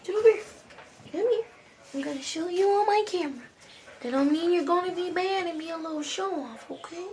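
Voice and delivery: high-pitched dog talk. Real, not acted. Talking to her dog.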